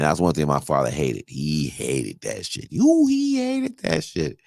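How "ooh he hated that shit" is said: ooh. Real, not acted. Emphatic retelling of dad.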